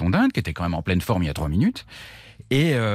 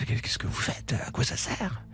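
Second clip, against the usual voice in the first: hushed whisper; a surprised whispered question; 2 semitones higher, 8 dB quieter than usual. Retold or imitated. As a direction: whisper it so nobody hears. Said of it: "je chuchote à la guérisseuse".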